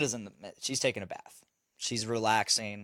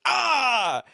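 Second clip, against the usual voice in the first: high loud charging yell; kid's battle yell; 12 semitones higher, 6 dB louder than usual. Retold or imitated. Imitating the son charging out with a yell.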